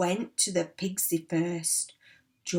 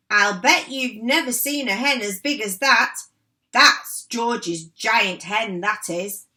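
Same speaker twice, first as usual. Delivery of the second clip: boastful grandma; 3 semitones higher, 6 dB louder than usual. Acted.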